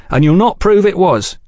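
high-pitched, firm dare; flat defiance. A narrator's reading. Velasco daring Oliphant to blame the agents, flat defiance. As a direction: defy him to prove it. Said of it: "Velasco stated flatly".

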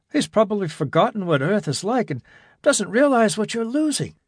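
a hint of a youth making plans; young man Jimmy, earnest.

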